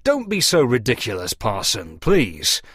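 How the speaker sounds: plain reading voice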